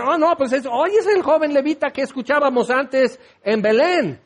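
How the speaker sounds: high-pitched, eager voice of someone recognizing a preacher; acted excited recognition. Preacher voices travellers recognizing the young Levite, mock excitement.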